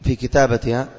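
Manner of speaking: ordinary talk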